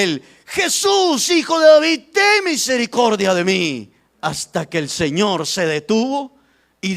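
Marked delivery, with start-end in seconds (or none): 0.5-3.9 high, loud plea starting at a shout; crying out. Acted. Blind man fights for his own need, crying out to Jesus. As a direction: shout the name, then come down on the plea.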